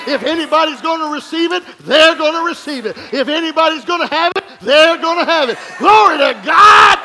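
loud, high, rhythmic preaching build; preaching at full force. Acted. Pastor preaching to his church, loud and climbing. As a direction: rouse the church to a shout.

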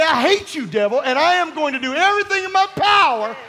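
preacher shouting a vow against the devil; defiant shout at the devil. Pretend. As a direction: shout it high and loud, furious.

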